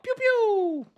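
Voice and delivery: high, loud pew pew blaster sounds; mock gunshot noises. Gamer mimics laser shots at the screen, high and loud. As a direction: fire off pew pew like a kid.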